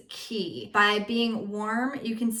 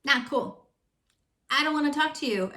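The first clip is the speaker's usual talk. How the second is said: disapproving; 6 semitones higher than usual. Shown as a demonstration. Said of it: "reacting to blaming example".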